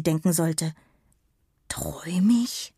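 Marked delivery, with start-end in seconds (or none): 1.7-2.7 slow, whispered murmur. A narrator's reading. Emma wonders whether she is dreaming, murmured. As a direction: murmur it slowly under your breath. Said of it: "murmelte sie".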